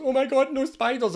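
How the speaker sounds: high-pitched acted fright; spider panic. Someone running out of the house from spiders, mock panic.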